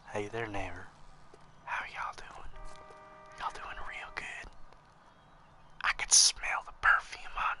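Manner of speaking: breathy creepy whisper, slow. Shown as a demonstration. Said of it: "I'm having too much fun being creepy".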